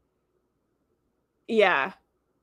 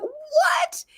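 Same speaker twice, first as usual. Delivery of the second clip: very high, incredulous squeal of a question; high-pitched, scandalized astonishment; retold disbelief; 12 semitones higher than usual. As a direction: replay your shock, pitch shooting up. Her outraged disbelief, retold.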